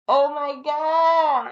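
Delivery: loud, high, hugely drawn out; awestruck exclamation. Retold or imitated. Seeing the fish pulled from water, amazed. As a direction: stretch every word in amazement, slow and loud.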